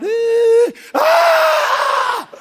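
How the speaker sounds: very high, loud squeal; terrified shriek. Retold or imitated. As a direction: scream like a frightened girl. Daughters screaming in fear.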